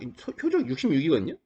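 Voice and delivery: ordinary talk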